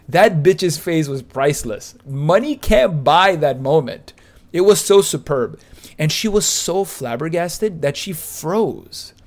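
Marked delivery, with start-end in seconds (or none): 0.1-5.6 loud, gleeful retelling; excited. Real, not acted. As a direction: relish the moment loudly. Excited retelling, laughing aftermath.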